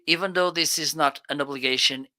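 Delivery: ordinary delivery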